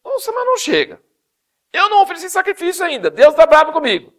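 high, loud panicky inner voice; mock anxious Saul. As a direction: fret out loud like a scared king. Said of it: "Mas ele pensou assim". (Saul aflito, voz aguda e alta)

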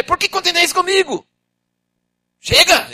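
high loud exasperated; angry Moses. Acted. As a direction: snap at the people. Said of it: "Ele já começou a ficar bravo".

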